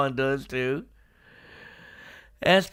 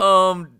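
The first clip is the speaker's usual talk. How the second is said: nervous um; 5 semitones higher, 9 dB louder than usual. Retold or imitated. Trunks hesitates before Vegeta.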